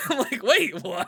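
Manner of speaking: high laugh, then a startled wait what; laughing disbelief. Retold or imitated. Retelling meeting a stranger, laughing in disbelief. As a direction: caught off guard mid-laugh.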